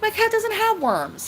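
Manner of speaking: high indignant protest; indignant denial. Retold or imitated. Telling off the neighbor about the worm pill, high indignant. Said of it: "I'm like".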